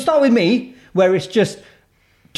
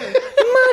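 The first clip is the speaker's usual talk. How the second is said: high laugh. Real, not acted. Laughing at the surprise.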